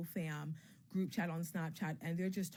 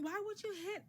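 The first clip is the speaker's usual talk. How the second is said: high-pitched mock clamor, held back in volume; mock yelling; 10 semitones higher, 3 dB quieter than usual. Friends protest after she hit Lorax, high mock yelling. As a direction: imitate a crowd protesting in a high, soft squeal. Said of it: "Everybody starts yelling".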